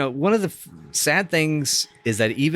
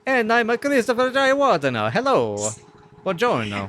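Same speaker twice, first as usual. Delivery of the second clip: fake Italian accent; 10 semitones higher than usual. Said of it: "with our big fake Italian accents".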